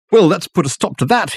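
a theatrical gentleman bowing his way out; Dirk's voice. Acted. Dirk pleased with himself.

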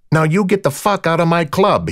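high, forceful dismissal; irate club boss, voice pitched well up; angry order. A narrator's reading. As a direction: order intruders out angrily. Club owner throws intruders out.

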